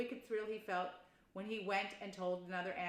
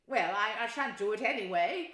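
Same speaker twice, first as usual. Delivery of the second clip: loud, worked-up refusal; excited; 9 dB louder than usual. Acted. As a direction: dig your heels in, loudly. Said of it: "cried Toad, getting excited".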